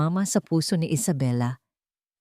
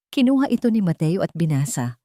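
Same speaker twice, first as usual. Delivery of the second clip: usual speaking voice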